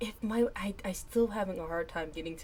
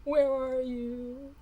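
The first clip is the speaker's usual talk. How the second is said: mock call out; 7 semitones higher, 7 dB louder, much slower than usual. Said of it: "okay, I'll stop".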